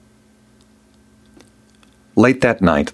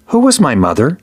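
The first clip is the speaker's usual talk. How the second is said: put-on higher, searching boy's voice; boy asking the king about his mother, voiced higher; boy's question; 7 semitones higher than usual. A narrator's reading. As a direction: ask it straight, a boy wanting the truth.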